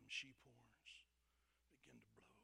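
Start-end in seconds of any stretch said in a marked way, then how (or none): none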